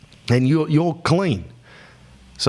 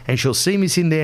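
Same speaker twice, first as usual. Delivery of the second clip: ordinary delivery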